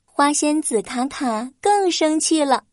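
ordinary delivery

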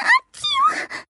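high, tearful sneeze. Acted. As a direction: sneeze with a sob. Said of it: "so cold".

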